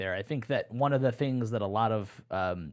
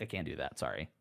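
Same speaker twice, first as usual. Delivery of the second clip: robotic refusal; 10 semitones lower, 11 dB quieter than usual. Pretend. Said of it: "it'll just be like".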